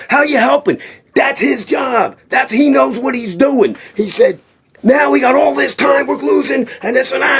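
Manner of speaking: hammed-up furious scolding; imitating Stallone yelling. Stallone scolding the trainer over wasted time, high mock shout. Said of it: "Stallone starts screaming".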